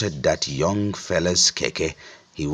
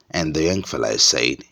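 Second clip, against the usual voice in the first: usual speaking voice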